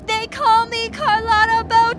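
head out car window. Acted. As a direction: yell it to the whole street. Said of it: "I'm gonna scream it to the world".

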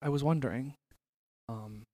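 shy mock date request. Mock asking her out.

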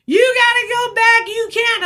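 high, pushed mock order from the gunman; angry gunman. Narrator voicing the gunman refusing to let them go, high mock order. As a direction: bark it up high like the angry man.

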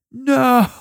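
high squeaky mock no; comic protest. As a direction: squeal a hammed-up refusal.

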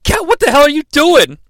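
high, loud startled yelp; alarmed Glenn. Retold or imitated. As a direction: jump back spooked and snap the question. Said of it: "he's like".

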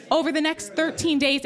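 usual speaking voice

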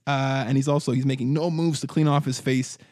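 usual speaking voice

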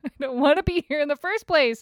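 venting about a bad morning, laughing whine; laughing high whine. Real, not acted.

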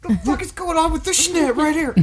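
squeaky goofy high voice, swearing; silly high voice. Acted.

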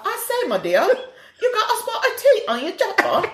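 high, laughing fake-British exclamation; hammed-up British swooping upward; mock British accent. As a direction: put on plummy British, amused. Answering in the British bit.

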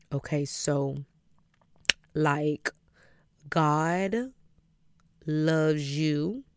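halting, long pauses between words; making it up. Retold or imitated. Improvising a word from God for a brother, halting and unsure. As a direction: stall and grope for each next phrase. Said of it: "I didn't know what I was talking about".

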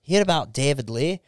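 put-on broad English pub accent; put-on English lad's accent; English pub bloke. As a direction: gossip like an English bloke over a pint. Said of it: "some English guys sitting around going".

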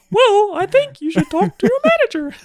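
very high squeaky cartoon mouse voice; Mickey Mouse voice. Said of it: "do the rest of the podcast in a Mickey Mouse voice".

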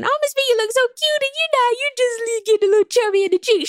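high, fast sing-song imitation of fans; sweet mock voice of commenters. Host mocking fans who call her chubby, high and fast. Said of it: "shout out to everybody who's saying".

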